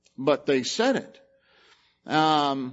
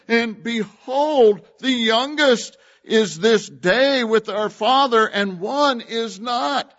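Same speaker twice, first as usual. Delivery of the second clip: raised, slow, louder reading of the verse; scripture reading; 6 semitones higher, 6 dB louder than usual. Acted. Preacher proclaiming the brothers' words, a high loud slow recital.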